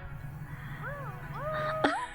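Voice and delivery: high squeaky giggle. Real, not acted. Laugh before the raid.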